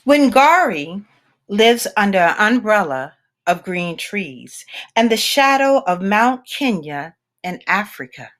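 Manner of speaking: plain reading voice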